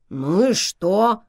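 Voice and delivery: slow, half-hearted retort; weak objection. A narrator's reading. Schweiger objects to Hermes's guess, weakly. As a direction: draw it out in feeble protest. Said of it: "слабо возразил Швайгер".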